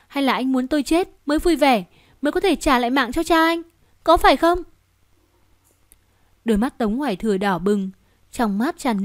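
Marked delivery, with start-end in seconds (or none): 0.1-4.6 rising anger. Acted. Cố Niệm accusing Tống Hoài Thừa, voice rising to a furious cry. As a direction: hurl the last question at him.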